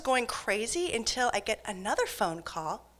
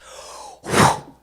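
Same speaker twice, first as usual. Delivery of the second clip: sharp loud mock gasp; sharp loud imitated gasp; startling gasp; 10 dB louder than usual. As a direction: gasp right in her face. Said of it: "she goes".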